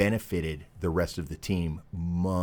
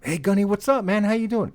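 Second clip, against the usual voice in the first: high, loud buddy-buddy hello; acted-out chummy call, pitched way up; hearty greeting; 14 semitones higher, 6 dB louder than usual. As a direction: greet an old pal with big put-on cheer. Acted hearty greeting to old Gunny.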